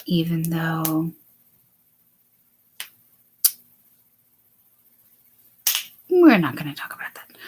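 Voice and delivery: whispered aside, quick at the end. Real, not acted. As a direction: drop to a secret whisper.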